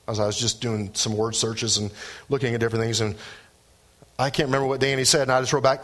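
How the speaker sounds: ordinary delivery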